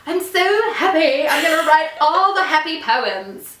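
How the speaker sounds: mock cheery. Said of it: "I'm not really like".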